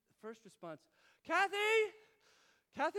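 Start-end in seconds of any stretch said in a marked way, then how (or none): 1.3-3.0 loud, high panicked yell; calling for wife. Retold or imitated. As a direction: holler for your wife to take over. Dad yells for his wife.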